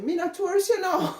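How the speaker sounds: imitating Irie's Jamaican patois brush-off; Jamaican patois quote. Irie telling street sellers she's no tourist, in mock Jamaican patois. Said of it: "and she said".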